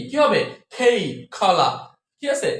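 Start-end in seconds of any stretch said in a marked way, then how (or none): 0.7-1.7 slow "খেই, খলা" with a breathy kh; aspirated K. Shown as a demonstration. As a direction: stretch out "খেই, খলা", puffing each k. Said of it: "খ দিয়ে করতে হবে".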